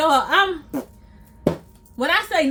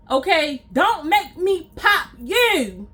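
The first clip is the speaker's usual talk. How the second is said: ordinary delivery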